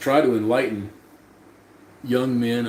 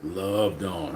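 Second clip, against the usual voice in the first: quiet echo; 6 semitones lower, 6 dB quieter than usual. Real, not acted. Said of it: "Undead is getting loved on".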